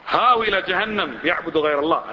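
ordinary talk, nothing marked

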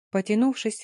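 usual speaking voice

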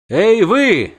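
high, loud hail; singsong siren call. A narrator's reading. Siren hails the men on the boat, singsong. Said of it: "пропела она".

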